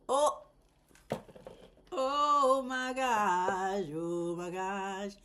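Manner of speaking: very slow, stretched out exclamation; drawn-out oh my gosh. Real, not acted. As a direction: let each oh my gosh linger. Overwhelmed exclamation.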